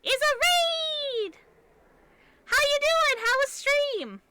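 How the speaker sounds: loud, high excited squeal easing slightly; real high, loud excitement; excited welcome. Real, not acted. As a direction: greet arrivals at the top of your range. Welcoming raiders on stream.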